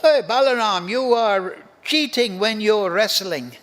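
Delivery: put-on loud, teasing protest from a cowherd boy; scolding cowherd boy. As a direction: call out a mock complaint to a playmate. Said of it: "And therefore the cowherd boys".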